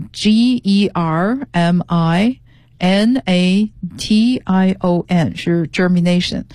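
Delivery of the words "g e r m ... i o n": slow, letter-by-letter spelling. Shown as a demonstration. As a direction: spell it out slowly for learners. Teacher spells word letter by letter. (一个字母一个字母地慢慢拼读)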